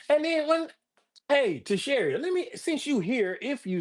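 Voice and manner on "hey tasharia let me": ordinary talk, nothing marked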